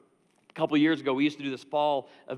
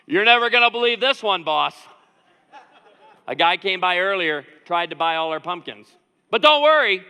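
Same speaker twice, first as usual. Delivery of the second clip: excited employee role-play; 2 semitones higher, 12 dB louder than usual. Acted. Johnny telling boss about pumpkin buyer, excited and loud. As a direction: play the proud worker bursting with news. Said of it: "I'm just gonna role play this".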